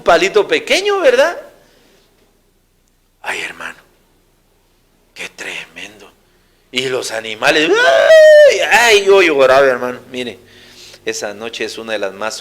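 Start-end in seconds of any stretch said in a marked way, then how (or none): 3.2-6.1 soft unvoiced whisper of dread; whispered sigh. Recalling terrifying night as child. 7.7-9.7 high, loud wail on ay; anguished cry. Retold or imitated. Reliving crying in fear.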